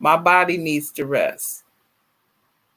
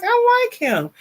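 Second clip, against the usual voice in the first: delighted squeal. Retold or imitated. Gushing about a kind fan, high delighted squeal. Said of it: "high squeal of delight".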